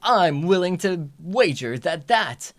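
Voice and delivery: loud, dramatic card-duel villain; Yu-Gi-Oh dub villain. Pretend. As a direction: ham it up like a dubbed duelist. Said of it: "the Yu-Gi-Oh voice too".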